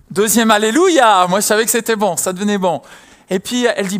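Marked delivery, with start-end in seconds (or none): 0.1-1.3 slow, loud second "alléluia" of relief; joyful cry. Retold or imitated. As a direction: ring out the praise, drawn out. Joyful exclamation at good news.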